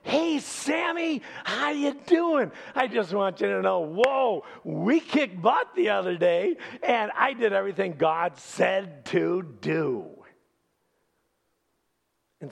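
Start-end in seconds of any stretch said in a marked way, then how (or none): 0.0-10.2 put-on much higher, bright and loud, hailing an old friend; hammed-up cheery king boasting to the prophet; cheerful greeting. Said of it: "Saul cheerfully greets him".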